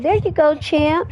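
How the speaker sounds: high mom voice. Acted. Mom sees son off to school, high cheerful voice.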